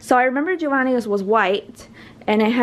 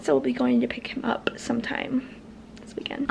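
whispering; 8 dB quieter than usual. Real, not acted. Aside whispered to camera.

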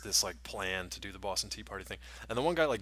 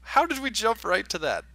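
incredulous; 14 semitones higher, 6 dB louder than usual. Real, not acted. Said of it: "incredulous aside".

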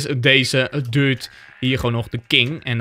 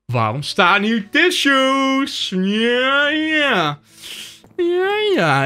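higher, slow, silly put-on voice; mock high voice; 12 semitones higher, much slower than usual. Gamer joking to viewers about tissues, mock high silly voice. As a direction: camp it up, stretching the words.

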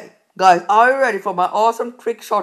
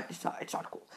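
much softer, unvoiced whisper; whispered aside; 18 dB quieter than usual. Real, not acted. Gamer muttering about the title, whisper. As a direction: whisper it to yourself.

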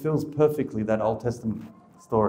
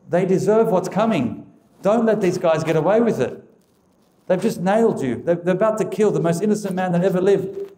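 high, loud, pleading protest; indignant outcry; 6 semitones higher, 4 dB louder than usual. Pretend. Preacher voicing someone urging Jesus to punish them, indignant high outcry. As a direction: demand justice at the top of your voice.

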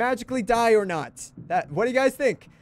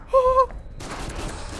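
high, voiceless gasp; sharp gasp; 13 semitones higher than usual. Real, not acted. Streamer startled by danger in the game, gasping. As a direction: suck in a startled breath.

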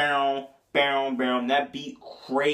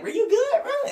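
high, put-on voice checking on the kid in the corner; squeaky mock concern; mock worried classmate.

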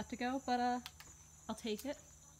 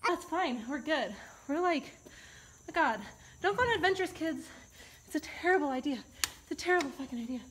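higher, louder, still rattled; shaken, breathless; 5 semitones higher, 6 dB louder than usual. Real, not acted. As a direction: talk through the leftover fright. Still shaken after panic.

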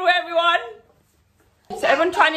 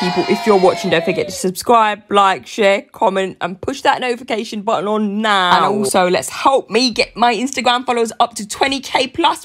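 loud subscribe plea; 4 semitones lower, 7 dB louder than usual. Real, not acted.

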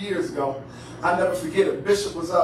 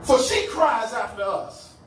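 punchy phrases with long pauses; dramatic scripture preaching; 5 semitones higher than usual. Acted. Preacher retelling woman's plea and disciples' rebuff, dramatic preaching. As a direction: act out the scene one weighty phrase at a time.

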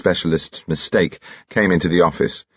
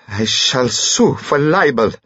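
slow, higher-pitched character voice with a foreign touch; indignant foreigner; 6 semitones higher, slower than usual. Acted. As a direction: deny it slowly, each word drawn out. Dimitri threatens, same character voice.

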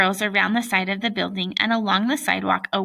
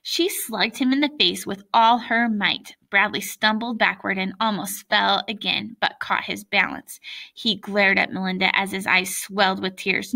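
usual speaking voice

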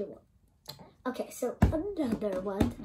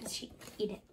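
whispering; 3 semitones lower, 13 dB quieter, much faster than usual. Real, not acted. Secret whispered.

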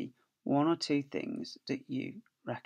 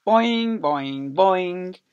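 bouncing boings; 4 semitones higher, 9 dB louder, much slower than usual. Acted. Kangaroo bouncing sounds.

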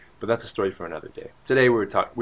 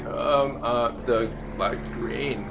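slow, stalling delivery with ums; imitating an awkward, halting explanation; hesitant reply; 2 semitones higher, much slower than usual. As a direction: feel your way awkwardly into the words. Retold awkward reply to driver.

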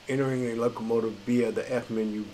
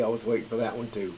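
ordinary talk

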